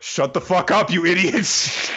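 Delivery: high-pitched, exasperated retold scolding; mock angry outburst. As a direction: snap it, then drag out the insult. Character angrily silences the others.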